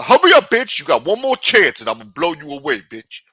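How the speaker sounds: high, loud robber's ultimatum, imitated; shouted threat. Robber to trembling woman forgetting the code, shouted threat. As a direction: bark the threat like an impatient robber.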